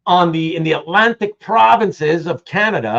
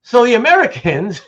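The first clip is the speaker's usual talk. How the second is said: ordinary talk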